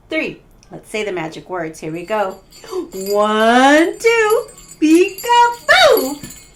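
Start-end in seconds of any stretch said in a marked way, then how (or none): 2.7-6.5 put-on kids party magician. Saying the magic words together.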